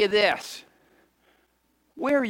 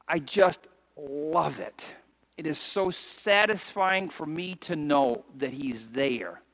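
low, soft and slow; tender testimony; 3 semitones lower, 3 dB quieter, slower than usual. Real, not acted. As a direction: speak it close, from the heart.

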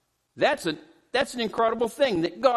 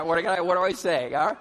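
normal talking voice

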